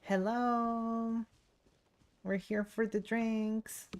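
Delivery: normal talking voice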